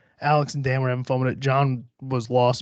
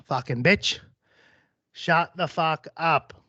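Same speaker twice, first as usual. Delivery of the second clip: slow, drawn-out Aussie annoyance; exasperated Australian killer; 3 semitones higher, slower than usual. Acted. Australian killer telling the interrupting woman off, exasperated. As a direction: spell out your irritation in an Australian accent.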